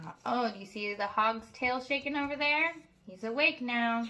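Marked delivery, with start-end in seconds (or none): none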